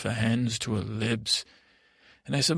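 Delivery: ordinary delivery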